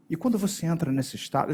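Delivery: ordinary delivery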